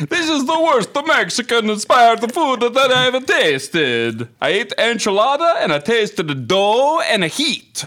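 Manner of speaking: high, loud hammed-up Italian accent; reading a bad review in a high, loud mock Italian accent. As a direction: overplay a comic Italian voice reading the complaint. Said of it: "it'll end up Italian".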